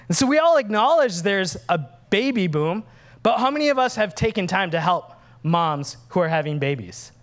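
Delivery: ordinary delivery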